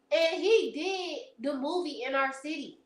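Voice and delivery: ordinary talk, nothing marked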